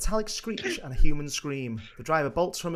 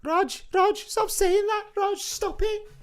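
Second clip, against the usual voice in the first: high, pleading, tearful voice; crying husband; slower than usual. Acted. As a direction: beg him to stop, near tears. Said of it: "George stands beside him, crying".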